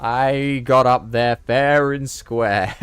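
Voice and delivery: loud, high, slow protest; real outcry at an unfair loss; indignant shout. Real, not acted. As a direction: holler it, stretching each word, near laughter. Protesting the fall amid laughter.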